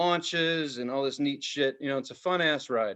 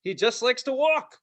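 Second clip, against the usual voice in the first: mock bragging guest; 8 semitones higher than usual. Quoting a boastful park guest.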